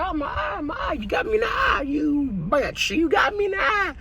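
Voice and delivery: high shouted cries of pain; hammed-up injured dinosaur shout; wounded T-Rex. T-Rex shot in the eye.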